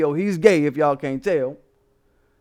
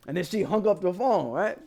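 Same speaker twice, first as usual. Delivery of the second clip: ordinary talk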